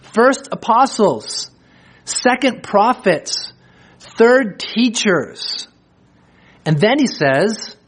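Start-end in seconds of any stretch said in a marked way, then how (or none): 0.0-5.7 very slow, higher, each rank spaced out; slow emphatic ranking; slow ordered list. Shown as a demonstration. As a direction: count First, second, third deliberately. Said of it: "Very clearly, he's giving an order".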